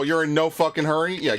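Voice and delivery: ordinary talk, nothing marked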